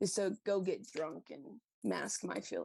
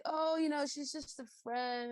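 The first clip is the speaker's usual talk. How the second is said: downplaying the truth; 7 semitones higher than usual. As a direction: play innocent, as if retelling an evasion. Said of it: "holding back on the truth".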